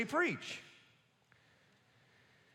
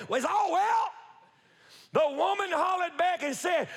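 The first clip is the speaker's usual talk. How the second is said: full-force preaching; 5 semitones higher than usual. Acted. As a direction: drive the story home from the pulpit. Retells miracle at full preaching force.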